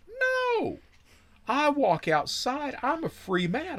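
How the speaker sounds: bursting with surprised delight; thrilled to walk out a free man; excited disbelief. Real, not acted. Sudden release from jail.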